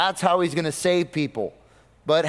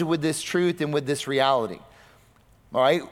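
ordinary talk, nothing marked

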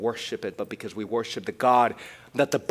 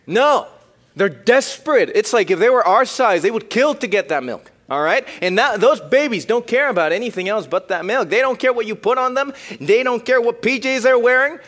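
high and loud, driving the point; emphatic shout; 11 semitones higher, 8 dB louder than usual. Real, not acted. Preacher insisting to the youth that babies crave milk, loud. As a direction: hammer it home loudly.

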